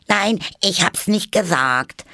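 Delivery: high, loud indignant denial; frantic high-pitched hare voice; hasty interruption. A narrator's reading. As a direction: cut in shrilly to deny it. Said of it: "unterbrach ihn der Faselhase schnell".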